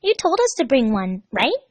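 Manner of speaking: put-on chirpy young girl asking; high girlish voice; Amy's girl voice. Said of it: "she grinned".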